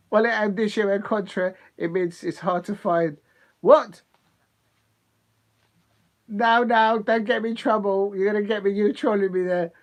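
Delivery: ordinary talk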